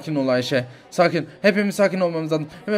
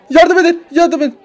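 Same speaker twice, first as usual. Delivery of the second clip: high, loud shouted plea; desperate yells; crying for help; 9 semitones higher, 13 dB louder than usual. Acted. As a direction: scream for help. Mother in labor calls help.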